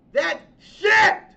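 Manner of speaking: loud, high outburst on "That shit!"; angry yell. Real, not acted. Host exploding over the show's ending, yelling. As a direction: blurt it out in disgust. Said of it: "that epic rant".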